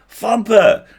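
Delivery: high, loud joyful cry; calling out to a friend; excited call. Acted. Goblins crowding the bed.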